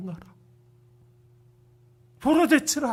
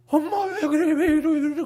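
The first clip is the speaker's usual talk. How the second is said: mock pleading; 9 semitones higher, 5 dB louder than usual. Eaglet pleading as mother tears nest.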